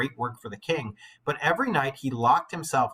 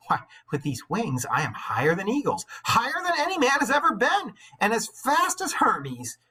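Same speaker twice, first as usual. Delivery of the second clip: soaring higher and higher with glee; exultant boast; 10 semitones higher than usual. Acted. Icarus boasting to his father while flying, rising exultant. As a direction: crow with wild joy up in the sky.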